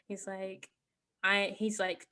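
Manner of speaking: ordinary talk, nothing marked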